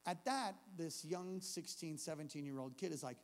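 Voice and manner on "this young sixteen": ordinary talk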